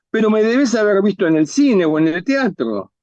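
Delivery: tono agudo de estrella incrédula; diva insistente. Acted. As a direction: insiste en que tienen que conocerte. (diva que insiste, aguda)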